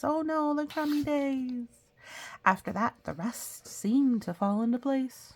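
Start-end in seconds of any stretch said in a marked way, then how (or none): 0.0-1.7 high, playful oh no; mock-horrified aside; mock dismay. Real, not acted. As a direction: tease the gloomy title.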